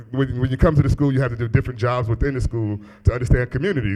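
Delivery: ordinary talk, nothing marked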